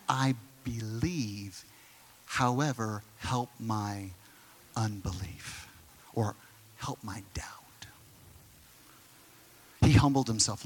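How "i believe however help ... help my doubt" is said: much softer, slow, fading to a whisper; a father quietly admitting his doubt to the Lord; humble plea. Retold or imitated. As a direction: confess it humbly, almost breathless.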